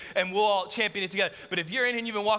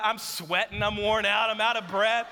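ordinary talk

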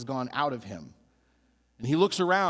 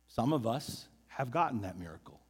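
quiet echo; 5 semitones lower, 5 dB quieter than usual. Shown as a demonstration. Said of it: "And some of us have gotten that miracle".

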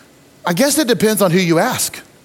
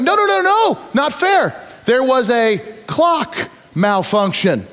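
mock protest; 6 semitones higher, slower than usual. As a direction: protest like a team robbed at the buzzer. Russians protesting the ending.

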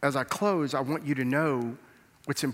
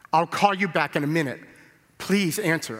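urgent plea; 5 semitones higher, 8 dB louder than usual. Retold or imitated. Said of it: "And he said".

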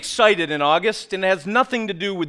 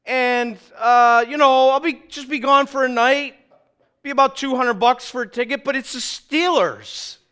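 pleading to wife; 8 semitones higher, 5 dB louder than usual. Retold or imitated. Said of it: "And then I go".

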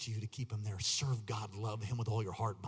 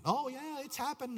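high-pitched mocking quote; falsely reassured, pitched way up; mock complacency; 13 semitones higher than usual. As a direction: mimic an overconfident listener in a high voice. Mimics complacent listeners, then contradicts them.

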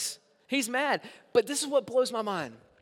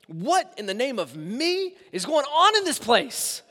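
indignant roar; 5 semitones higher, 5 dB louder, slower than usual. Retold or imitated. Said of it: "he basically is saying".

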